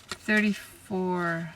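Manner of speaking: slow, drawn-out repeat of the number. Real, not acted. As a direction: let the number linger, slowly. Repeats the card number slowly.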